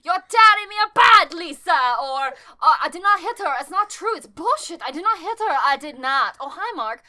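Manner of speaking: put-on lightly done Tommy Wiseau accent, odd vowels; hammed-up Wiseau line readings, a touch loud; Tommy Wiseau impression. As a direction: quote the movie in a loose Wiseau accent. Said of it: "when you started doing that accent".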